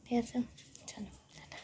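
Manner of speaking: hushed, voiceless whisper throughout. Real, not acted. Mother whispering to viewers beside her sleeping daughter, hushed whisper. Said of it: "চলো একটু আস্তে আস্তে কথা বলছি, কারণ রুহি উঠে যাবে".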